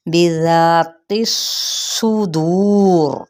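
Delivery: الصدور with the ص drawn out and hissing, very slowly; whistled sad. Shown as a demonstration. Teacher showing students the whistling ص sound in الصدور. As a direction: stretch the hiss of ص in الصدور like a whistle. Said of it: "سیٹی کی آواز نکالنی ہے".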